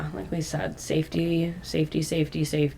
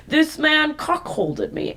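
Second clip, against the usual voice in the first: put-on drunk man; 10 semitones higher, slower than usual. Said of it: "drunk as shit and he's like".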